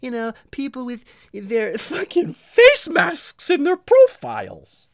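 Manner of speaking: high, loud, slow mocking voice of a critic. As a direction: nag at Max in a shrill put-on voice.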